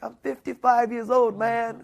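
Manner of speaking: retold tearful disbelief. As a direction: cry out your age like you can't believe it. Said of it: "and he was crying".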